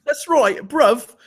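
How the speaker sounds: laughing mock English accent. Host laughs along with his co-host in a mock English accent. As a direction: laugh through it in a put-on London English accent. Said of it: "we're throwing on our English accents today".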